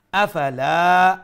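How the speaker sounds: আফালা with the final a drawn out; loud, slow recited আফালা; one-alif lengthening. Shown as a demonstration. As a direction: stretch the লা of আফালা. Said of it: "এক আলিফ লম্বা".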